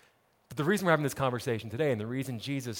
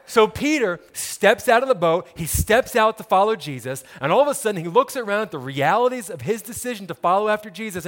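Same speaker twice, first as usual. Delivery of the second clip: loud, raised preacher's telling; preaching at full force; 5 semitones higher, 7 dB louder than usual. Acted. Pastor telling the congregation Peter's step, preaching loudly. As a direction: drive the story home with energy.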